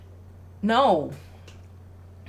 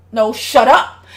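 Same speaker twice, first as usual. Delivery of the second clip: louder retold shout; 8 dB louder, slower than usual. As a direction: snap it like the dad. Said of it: "the dad just one day was like".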